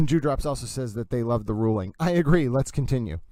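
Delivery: ordinary talk, nothing marked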